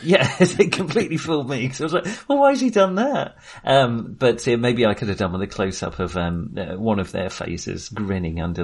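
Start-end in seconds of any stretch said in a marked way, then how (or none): none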